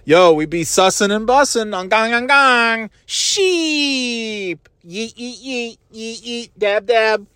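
dad clowning in a high voice; hammed-up teen slang. Dad clowning for his kids in a high mock voice. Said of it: "laughing themselves silly".

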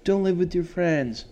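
high mock-preachy voice; mocking naysayers. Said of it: "a lot of people might say".

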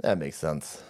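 a dim believer buying it; mock gullible agreement. Mocking believers, low dopey agreement. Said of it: "and be like".

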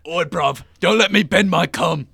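loud, high mock cockney threat; mock British accent. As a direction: bark it like a cheeky British thug. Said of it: "Definitely be British".

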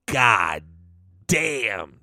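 low, drawn-out God then damn with a pause; admiring god damn. Retold or imitated. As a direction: savour it like you've just seen her picture. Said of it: "and you go".

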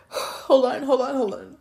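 fighting back sobs to get the words out; real tearful plea. Real, not acted. Asking viewers to wait while she cries, tearfully. Pausing the story while crying.

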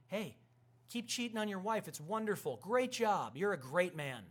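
gushing fake praise, pitched high; sarcastically upbeat congratulations; mock approval.

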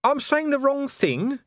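slow, mock-innocent apology; innocently. A narrator's reading. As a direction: feign innocence, drawing it out. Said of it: "he said innocently".

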